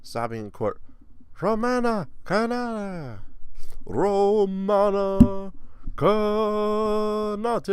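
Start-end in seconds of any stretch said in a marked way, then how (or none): 1.4-7.7 loud, high, long-held syllables; hammed-up name announcement. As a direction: stretch the name out grandly. Hams up the foreign name.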